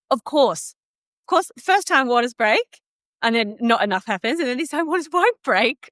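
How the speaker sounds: high, sardonic of course; exasperated irony. Retold or imitated. Mum in labour mocking her own luck, high and sardonic. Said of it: "So I was like".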